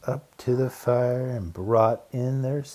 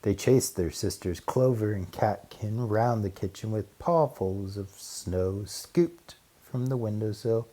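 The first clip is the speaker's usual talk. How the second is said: ordinary delivery, nothing marked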